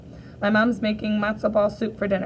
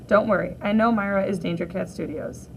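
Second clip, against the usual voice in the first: usual speaking voice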